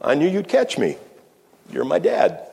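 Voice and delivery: lightly voiced little boy answering his dad; trusting boy. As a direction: say it as an obvious fact to a child's hero. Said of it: "And the little boy said".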